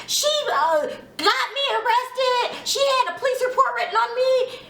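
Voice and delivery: high, wailing imitation of a meltdown; mock mental breakdown. Recapper mimicking castmate's confession, high mock-meltdown voice. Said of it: "seventy-two hour holdish mental breakdown way".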